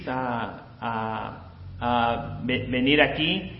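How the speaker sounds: normal talking voice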